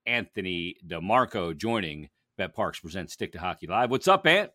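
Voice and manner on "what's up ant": high, loud greeting; excited greeting. Real, not acted. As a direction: call out to your guest. Greeting the guest.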